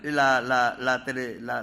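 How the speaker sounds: ordinary talk, nothing marked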